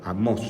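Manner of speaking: Amós with the stress on the final O, a-MÓS. Shown as a demonstration. Teacher correcting the class's stress, acento en la O de Amós. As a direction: lean on the last syllable of Amós. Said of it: "porque tiene acento en la O".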